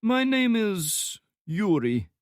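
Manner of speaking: put-on slow, high thick Slavic accent; heavy Slavic accent. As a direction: drawl the name, Slavic heavy. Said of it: "speaking in a heavy Slavic accent".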